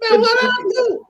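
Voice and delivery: pretending to be wrongly accused; mock innocence. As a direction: squeal it like you did nothing. Friend pleads innocence.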